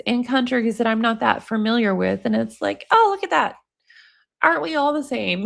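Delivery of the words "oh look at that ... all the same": pleasant surprise. Retold or imitated. Reacting to videos of other cultures.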